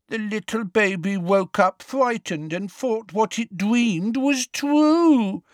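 put-on high, sing-song baby talk; mock baby voice. A narrator's reading. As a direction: coo it like cruel baby talk. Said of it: "in a horrible mock baby voice".

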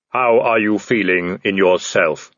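level, booming supernatural figure; Death. Acted. Death addressing the man in the tree, solemn intoning. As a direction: intone it evenly, without hurry.

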